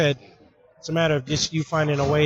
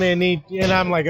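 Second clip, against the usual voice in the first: normal talking voice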